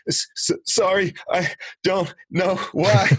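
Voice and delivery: choked, stammering apology, pitched high; reliving a sob through the words; retold crying apology. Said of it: "I had no idea why I was crying".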